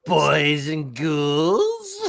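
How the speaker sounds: slow, laughing ghoul host greeting; hammed-up Crypt Keeper welcome. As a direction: drag it out like a cackling crypt host. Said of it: "And I can do the Crypt Keeper".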